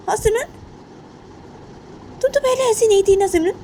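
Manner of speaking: high-pitched, incredulous scolding; shocked scolding mother. Acted. Mother amazed at Simran's anger, scolding in disbelief.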